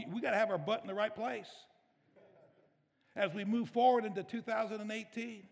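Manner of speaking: loud, emphatic sermon delivery; forceful preaching. Acted. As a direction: preach it out, punching key words.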